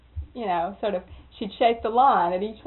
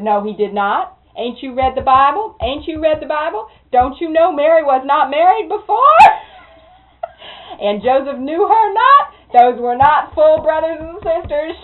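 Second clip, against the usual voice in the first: louder, higher indignant rebuke; indignant loud scolding; 6 semitones higher, 11 dB louder than usual. Retold or imitated. Miss Mary scolding the nephew about the Bible, loud and indignant. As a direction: scold him like a church elder.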